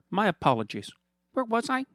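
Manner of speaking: high, sugary mock-friendly villain voice; smug villain Fernando. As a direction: purr at him like a gloating rich villain. Fernando resumes.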